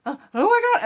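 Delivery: high, loud imitated panic; panicked gasp. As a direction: voice the daughter's first real panic.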